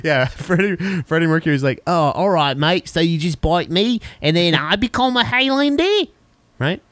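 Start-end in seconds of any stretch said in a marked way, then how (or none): 1.9-6.1 a light attempt at a British accent. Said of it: "Is that his accent?".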